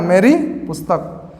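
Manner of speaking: usual speaking voice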